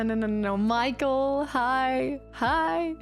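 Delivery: usual speaking voice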